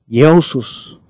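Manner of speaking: Iousus with a gliding "yo-u" opening; Greek pronunciation. Shown as a demonstration. Teacher showing the class the Greek name, Greek pronunciation. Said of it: "en griego es".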